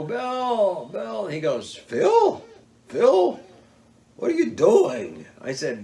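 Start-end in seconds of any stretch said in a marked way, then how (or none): 0.0-1.3 high, raised call across the road. Retold or imitated. Hunter calls out to his friend Bill on the road, raised call. 1.8-5.3 much higher, louder astonished calling out; surprised call. Retold or imitated. The guide calls back to the stranded hunter, astonished shout.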